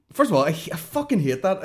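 ordinary talk, nothing marked

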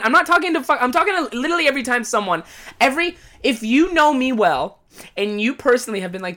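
usual speaking voice

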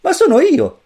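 put-on very high, loud boyish cry; jumping up excited. A narrator's reading. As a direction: burst out in surprise. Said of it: "saltò su il figlio". (il bambino salta su, eccitato e acuto)

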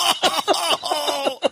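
high-pitched, laughing "oh" of regret; laughing groan. Real, not acted. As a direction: wail through your own laughter. Groans laughingly at his blunder.

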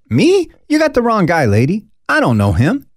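high, loud, flustered brush-off; frightened man insisting he's a stranger; panicked denial. Acted. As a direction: play scared Peter wriggling out. Peter denies knowing Jesus.

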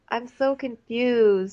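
high, drawn-out confused imitation; mock bewilderment. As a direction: stretch it out high, playing clueless.

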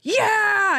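high mock cheer of "Yeah!"; retold cheer. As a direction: cheer it out like from the back of the hall. Conan cheering in the hall.

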